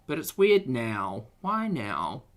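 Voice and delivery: normal talking voice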